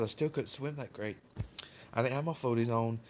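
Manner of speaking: ordinary delivery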